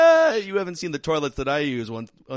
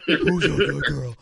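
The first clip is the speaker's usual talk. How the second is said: talking to a dog. Real, not acted. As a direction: coo at the dog. Greeting the dog Stella.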